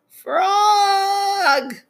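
very high, drawn-out call; long wailing cry. Acted. Toad calling for his lost friend, wailing cry. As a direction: wail the name out long and high. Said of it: "cried the thing".

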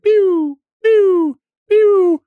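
high, loud pew pew sound effects; imitated firework. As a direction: mimic rockets whistling off. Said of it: "it shoots stuff up one at a time".

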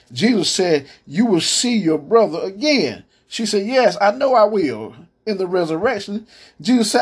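high, rising sermon retelling; preaching at full force. Acted. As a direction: carry the story up in pitch with fervor. Preaching the Lazarus dialogue.

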